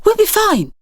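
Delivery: calm his mother down bravely; a son tells his mum they'll be fine; boy's reassurance. A narrator's reading.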